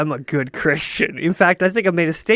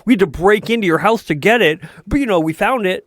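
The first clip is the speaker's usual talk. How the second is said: mocking cop voice; 5 semitones higher, 5 dB louder than usual. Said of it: "it's like".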